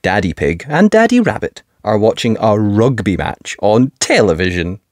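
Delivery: usual speaking voice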